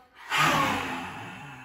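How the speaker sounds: loud high breathy roar; lion's breath exhale. Shown as a demonstration. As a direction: blast the air out with tongue out. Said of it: "Let's do three together".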